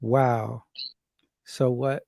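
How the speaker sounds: ordinary talk, nothing marked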